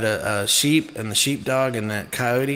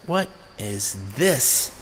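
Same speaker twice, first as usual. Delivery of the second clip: quiet awe; slower than usual. Retold or imitated. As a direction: let it sink in slowly. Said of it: "And I was like".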